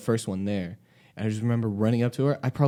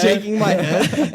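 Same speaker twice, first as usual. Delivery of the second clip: laughing; 10 semitones higher, 8 dB louder, slower than usual. Real, not acted. Teller laughing at own story.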